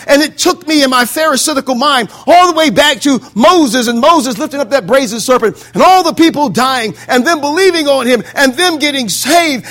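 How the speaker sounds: forceful pulpit delivery building to a climax; impassioned preaching. Acted. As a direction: preach it as an urgent testimony. Builds imagined testimony in full preaching.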